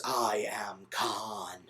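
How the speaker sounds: low, hushed, drawn-out villain reveal; Benedict Cumberbatch. Retold or imitated. Retelling dad's account of the villain's reveal, slow low mock-dramatic. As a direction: intone it slowly and gravely, dropping low. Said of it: "Benedict Cumberbatch goes".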